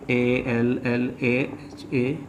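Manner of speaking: very slow, soft letter-by-letter spelling; spelling aloud. Real, not acted. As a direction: murmur each letter as you write it. Spelling letters aloud while writing. (धीमी आवाज़ में एक-एक अक्षर बोलना)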